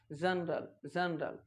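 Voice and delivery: जनरल said low and soft with j; correct pronunciation. Shown as a demonstration. Teacher giving correct pronunciation: soft j. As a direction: say जनरल slowly with j. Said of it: "correct pronunciation".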